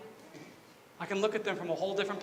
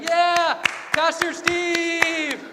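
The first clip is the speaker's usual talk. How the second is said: high, loud, drawn-out cheering yell; imitated crowd cheer; 8 semitones higher, 6 dB louder, much slower than usual. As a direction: holler like an excited congregation, stretching the name. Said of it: "And everyone's like".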